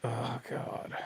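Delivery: breathless whispered oh God. Real, not acted. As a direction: whisper it in disbelief. Reacting to rant.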